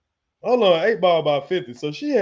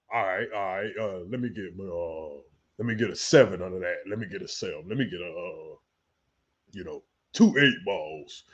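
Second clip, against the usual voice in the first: much lower and softer, a low hush; hushed dealer voice; 12 semitones lower, 5 dB quieter than usual. Acted. Cook ordering drugs on the sly, low hushed voice. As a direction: keep it low so nobody overhears.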